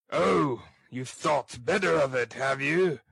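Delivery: loud, growling ruffian; surly, emphatic growl. Acted. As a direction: growl it as a sarcastic bully. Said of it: "growled Sikes".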